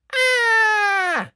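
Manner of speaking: very high, loud, held shriek; witch's shriek. Acted. Witch splattered with yogurt, high shriek. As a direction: shriek in outrage. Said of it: "shrieked the witch".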